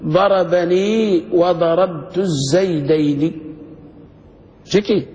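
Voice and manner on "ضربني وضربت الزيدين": very slow, every syllable drawn out; slow model reading. Shown as a demonstration. Teacher shows students how to pronounce the sentence, slow and clear. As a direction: recite it like a grammar example on the board. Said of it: "قل".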